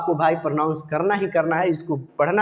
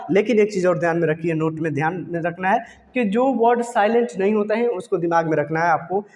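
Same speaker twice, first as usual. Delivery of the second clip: normal talking voice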